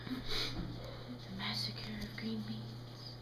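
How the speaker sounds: slow, fading whisper; hushed mock-dramatic ending; ominous whisper. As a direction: trail away as if haunted.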